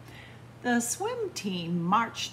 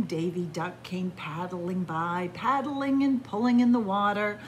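ordinary delivery

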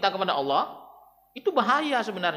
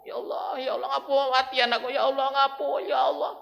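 high anguished wailing cries to God; wailing grief; 7 semitones higher than usual. Acted. Preacher voicing a grieving parent, wailing. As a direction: cry out like a parent who lost a child.

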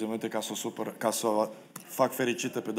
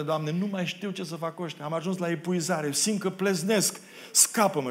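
higher, building desperate plea; exasperated plea; 7 semitones higher, 4 dB louder than usual. Acted. Moses to God about the people, exasperated plea. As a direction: drag out the breaking point, then beg for rescue.

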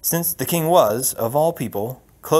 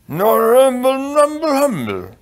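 loud, high nonsense mumble; mumbling king; 12 semitones higher, 7 dB louder than usual. Acted. King trailing on after his news, high-pitched mumbling. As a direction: drag the syllables out high and slow.

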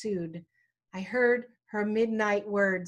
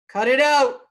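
loud, higher retold shout; sudden raised-voice command, acted; imitated fatherly yell; 4 semitones higher, 10 dB louder than usual. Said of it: "He yelled".

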